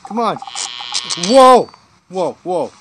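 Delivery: high, loud yells building to a startled shout; excited yelling. Real, not acted. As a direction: lose it mid-chase, shouting. Frantic chase, yelling.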